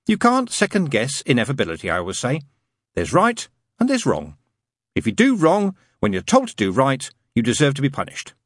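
a fussy angel stating a rule sincerely; prim angel. Acted. Angel telling the demon he can't do good, earnestly prim.

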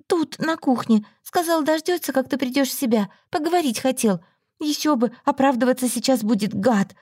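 lightly voiced indignant friend; Olga's scornful voice. A narrator's reading.

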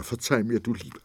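wife confesses her worry, sobbing; tearful. A narrator's reading. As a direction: apologise through tears. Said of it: "Sie schluchzte und bebte".

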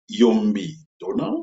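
normal talking voice

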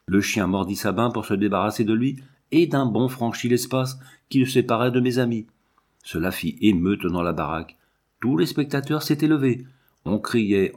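ordinary delivery, nothing marked

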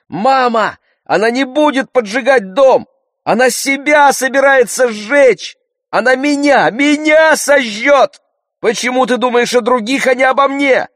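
high, loud, desperate scream. A narrator's reading. Girl screams to mother that she'll burn herself, desperately. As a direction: cry out at full pitch, pleading. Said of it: "и закричала".

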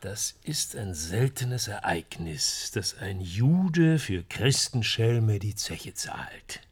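slow, measured recital; reading from a book. Acted. Man quoting the novel to a colleague, reading slowly. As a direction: read it out slowly, weighing each phrase.

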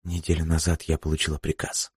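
hushed, reluctant admission; timid confession. Acted. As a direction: confess it softly, almost too quiet to hear. Бланка робко признаётся Джерри. (тихое, робкое признание)

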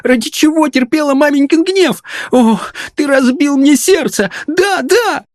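very high-pitched heartbroken wailing; hysterical lament. A narrator's reading. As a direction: wail as if breaking into sobs. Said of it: "И она истерически зарыдала".

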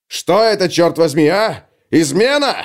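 loud, high outraged questioning; furious demand. Acted. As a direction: shout it in outrage. Captain shakes Parry furiously.

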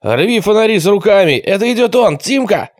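high, urgent battle cries; shouted orders. A narrator's reading. Gang leader rallying boys against the ambush, shouted. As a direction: shout commands over a scuffle. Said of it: "крикнул Квакин".

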